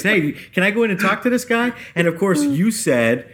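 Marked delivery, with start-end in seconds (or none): 0.0-1.7 higher-pitched eager asking; imitating guildmate's shtick. Retelling guildmate asking before the fight, imitated shtick. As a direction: pitch it up, playing her usual bit. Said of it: "it's one of her shticks".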